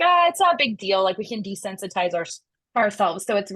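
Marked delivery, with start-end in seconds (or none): 0.0-1.1 breezy dismissive. Retold or imitated. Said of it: "they're like".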